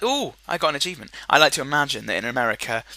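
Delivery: ordinary delivery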